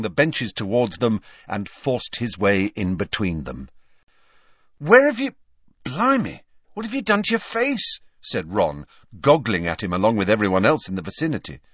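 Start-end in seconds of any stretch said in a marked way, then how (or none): 4.8-8.0 high, startled cry breaking off midway; shocked exclamation. Acted. Ron to Harry, shocked at his bloodied face, high and startled. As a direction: gasp it out, stunned by what you see.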